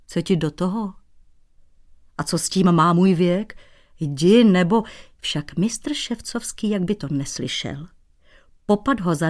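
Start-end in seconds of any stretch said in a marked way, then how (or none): none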